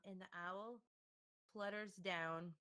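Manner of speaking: ordinary talk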